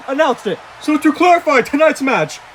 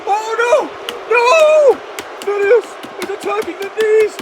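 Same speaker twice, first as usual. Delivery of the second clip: horrified commentary; 7 semitones higher, slower than usual. Acted. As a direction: cry out as the attack unfolds. Commentator horrified by knee attack.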